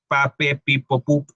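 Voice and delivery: pa, pe, pi, po, pu with a crisp P on each; P syllable drill. Shown as a demonstration. Teacher drilling the P sound the way it is taught in kindergarten. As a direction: pop each P syllable cleanly. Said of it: "el sonido de la P viene de ahí".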